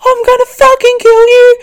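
shrieked very high and loud, hammed-up; blinded screaming rage. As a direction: scream it like a blinded villain flailing about. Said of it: "Elle just flailing around in the background like".

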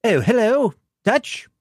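high, hearty officer voice; Brigadier voice. Acted. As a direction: greet the agent like an old commander.

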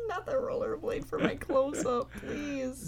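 high, soft voice squeezed by laughter; cracking up, weakly pleading; helpless laughing. Real, not acted. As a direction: struggle to get the words out through giggles. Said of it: "I'm fighting for my life".

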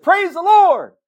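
sudden high, loud shout of praise; yelled praise. Retold or imitated. Cook yells praise after a coworker cusses, loud shout. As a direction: belt it out over the kitchen noise. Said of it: "screaming praises to God".

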